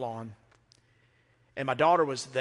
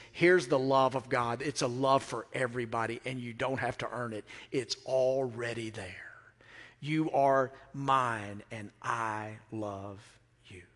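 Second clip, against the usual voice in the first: tender voice of Jesus; 3 semitones lower than usual. Retold or imitated. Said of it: "Jesus says".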